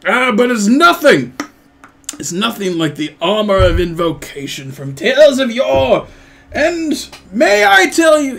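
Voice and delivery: loud, high, grandiose dwarf voice; blustering dwarf, swelling with relish; boastful dwarf storyteller. Acted. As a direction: launch into a tall tale of old legends. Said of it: "he's like".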